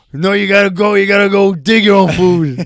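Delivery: loud, mocking Arabic accent dad. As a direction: put on a thick Arabic accent. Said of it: "In an Arabic accent".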